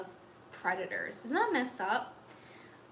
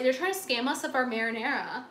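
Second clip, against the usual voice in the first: ordinary delivery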